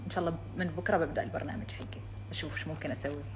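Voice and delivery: همس. Real, not acted. حديث خافت للكاميرا.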